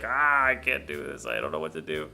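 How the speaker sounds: mock helpless groan, dropping low at the end; lightly hammed-up exasperated cry sinking into resignation; imitating helpless person. Reviewer imitating DeVito as helpless person, mock despair. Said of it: "kinda like the helpless person".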